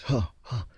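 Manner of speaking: two quick, high huffs; imitated gasp. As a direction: gasp as if startled on the run. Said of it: "we can clearly hear a gas".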